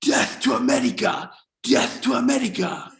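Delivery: high-pitched mock chant, no real volume; mock screaming. Supreme leader of Iran screaming at the TV, mock screaming. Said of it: "is screaming at the T V".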